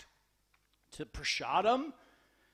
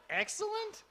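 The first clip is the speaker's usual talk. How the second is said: high, soft incredulous echo; imitating a puzzled bystander; baffled disbelief; 10 semitones higher, 6 dB quieter than usual. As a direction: question the word as if it's outrageous. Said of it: "They look at you like, "What?".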